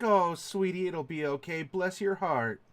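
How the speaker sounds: soothing, lightly voiced older woman; middle-aged woman. Woman in the hay to an upset stranger, soothing. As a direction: comfort like a motherly woman, drawing out the ending. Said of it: "maybe, like, a middle-aged woman's voice".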